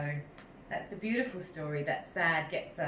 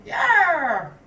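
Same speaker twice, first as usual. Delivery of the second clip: loud high bark-like yar; 10 semitones higher, 10 dB louder than usual. A narrator's reading. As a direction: bark it like a happy dog. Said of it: "Sad barked".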